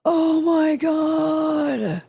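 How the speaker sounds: drawn-out, slow squeal of delight; overwhelmed gush. Real, not acted. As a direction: stretch it out like you can't take the cuteness. Overwhelmed by a dog photo.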